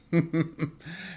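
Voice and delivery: quick airy chuckle; a little laugh at himself; short laugh. Real, not acted. Laugh after own joke.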